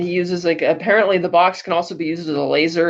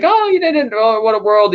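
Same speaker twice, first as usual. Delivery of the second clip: high mock lament, a little louder; feigned defeat; 4 semitones higher, 4 dB louder than usual. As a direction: wail it like a hammed-up beaten villain. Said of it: "Two-Face feigns defeat".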